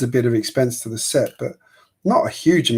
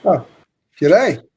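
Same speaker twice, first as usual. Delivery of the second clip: hammed-up Aussie greeting, higher and louder; playful broad Australian accent; 4 semitones higher, 4 dB louder than usual. Shown as a demonstration. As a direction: call out a put-on Australian hello. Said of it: "working on my Aussie accents".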